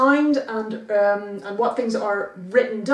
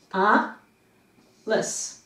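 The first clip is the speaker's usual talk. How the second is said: "A" then "lis" said apart, a pause between; split syllables. Shown as a demonstration. Teacher splitting a name into syllables. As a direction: break "Alice" into "A" and "lis". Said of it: "Alice has two sounds".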